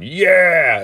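high, loud cheering holler; big re-enacted cheer; crowd yelling, imitated. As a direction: yell it like a rowdy neighbor. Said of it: "they just start laughing and yelling".

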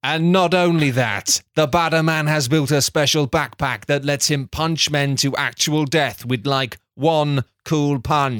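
measured, deadpan storyteller read; deliberate storybook delivery; story narration. A narrator's reading. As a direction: narrate a silly recap like a serious tale. Script narration.

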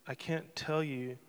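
ordinary delivery

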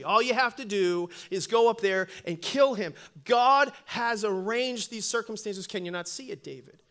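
preacher voicing a loud inner urge; screaming emotions. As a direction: goad him forcefully, command by command. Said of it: "the volume of the emotions are saying".

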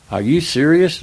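can't believe what he's hearing; incredulous. Retold or imitated. Director asking Ted if he's serious, incredulous. Paul's disbelief at doing it again.

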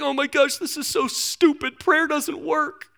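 high, hammed-up whiny complaint; mock negativity. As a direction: act out a fed-up quitter, dragging the last words. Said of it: "getting negative".

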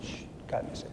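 unvoiced, soft "shh, cálmese"; God whispering calm to an anxious pastor; God's whisper. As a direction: hush like a gentle voice from above. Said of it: "Y ahora Dios dice".

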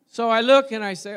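ordinary delivery

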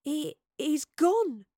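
slow, high, halting words; stunned stammer. A narrator's reading. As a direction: stammer it out in shock. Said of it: "Astrid stammered".

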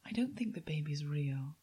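whispered, hushed theatre aside. Retold or imitated. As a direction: whisper it in the interval. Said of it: "she was like".

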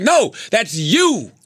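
very slow "No", stretched "you"; retold punchline. Comic retelling Dave's answer to theater, slow emphatic punchline. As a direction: draw out both NO and YOU for the reveal. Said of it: "He's like".

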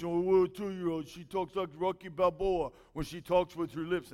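put-on a touch of a toddler doing Rocky Balboa, lips pushed. Shown as a demonstration. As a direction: lightly mimic a little girl mumbling like Rocky. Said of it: "London likes to talk like this".

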